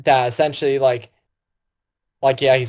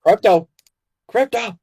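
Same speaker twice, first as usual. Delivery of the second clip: mock calling for a lost dog, rising high; 7 semitones higher than usual. Superman calling for Krypto in the fortress, mock call. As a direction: call the dog's name across a room.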